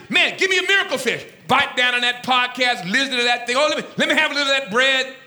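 high, quick, hungry banter; disciples' eager chatter. Retold or imitated. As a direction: rattle it off loud and greedy. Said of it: "They're probably saying".

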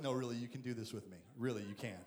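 calm him down softly; low, tender God encouraging Moses; reassuring God. Retold or imitated.

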